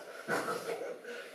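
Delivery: short real chuckle; laugh. Real, not acted. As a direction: laugh briefly at your own admission. 自嘲做不到布施头. (忍不住笑一声)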